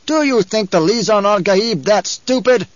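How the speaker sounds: high, slow angry challenge; hurled in anger. A narrator's reading. Paul rebuking the crowd before they respond, angry. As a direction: hurl it angrily at the crowd. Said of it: "hurled his voice at them in anger".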